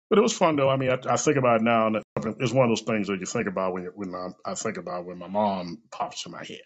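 ordinary delivery

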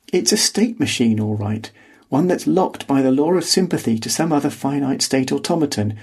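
ordinary delivery

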